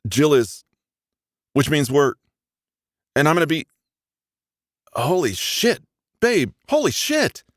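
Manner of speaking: building from halting to a high, excited outburst; overjoyed disbelief that breaks loose; stunned joy. Acted. Man learns his girlfriend is pregnant, disbelief turning to joy.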